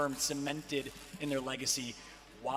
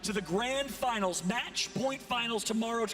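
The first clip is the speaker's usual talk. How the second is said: announcer build-up; 5 semitones higher, 3 dB louder than usual. Acted. Caster introduces the results to viewers, loud announcer build-up. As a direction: sell the stakes to the crowd.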